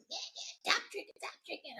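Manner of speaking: high, hushed mimicry of nagging; imitated nagging. Mimicking mom's reminders in her mind, nagging. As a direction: nag in a small, high voice. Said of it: "always nagging me".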